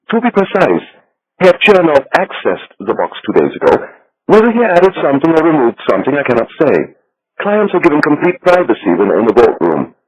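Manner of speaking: little Swiss banker. A narrator's reading. Becker explains the box.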